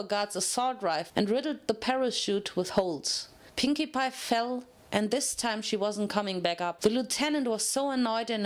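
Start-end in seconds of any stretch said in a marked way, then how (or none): none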